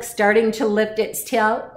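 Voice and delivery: usual speaking voice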